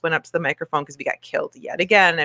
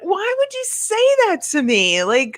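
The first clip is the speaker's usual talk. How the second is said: much higher, slow, wounded squeal; hurt disbelief; 12 semitones higher than usual. Retold or imitated. Bartender replaying how she felt at the customer, hurt and squeaky. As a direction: plead like you can't believe she said it.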